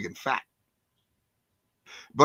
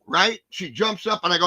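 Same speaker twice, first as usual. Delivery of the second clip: ordinary talk, nothing marked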